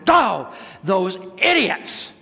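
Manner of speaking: exasperated outburst. Retold or imitated. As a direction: explode at the culprits, re-enacting it. Reacts to chairs crashing down.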